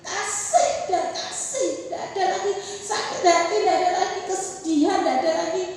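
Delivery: loud, high, building sermon cadence; rising fervent sermon delivery; full-force preaching. Acted. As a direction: preach it to the congregation.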